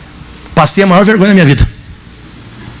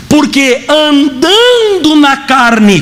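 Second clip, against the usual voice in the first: "andando" hugely stretched and raised; emphatic verse reading; 4 semitones higher, much slower than usual. Real, not acted. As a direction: draw out "andando" slowly and high. Preacher emphatically reads out verse. (ênfase, "andando" bem arrastado e agudo)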